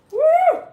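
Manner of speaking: loud, high-pitched "woo"; high loud whoop. Real, not acted. Reacting to a gassy dog on a livestream, high whoop.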